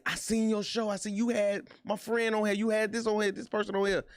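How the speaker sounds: excited fan. Retold or imitated. Fans at work gushing to him about his show, excited and high. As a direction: gush like a fan spotting him.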